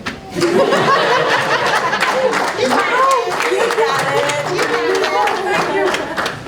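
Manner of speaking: long high laugh while choking up on her daughter's name; emotional laugh. Real, not acted. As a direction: laugh it off to hold back tears. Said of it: "Oh, this is bad".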